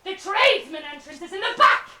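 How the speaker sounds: loud, haughty shout. Acted. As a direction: shout him toward the back door. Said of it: "he shouted".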